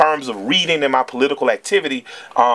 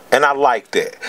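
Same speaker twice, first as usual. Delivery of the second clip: ordinary talk, nothing marked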